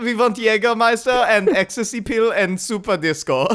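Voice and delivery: high, comic German clubber; greedy demand in hammed-up German accent; mock German accent. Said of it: "the crew was mostly German".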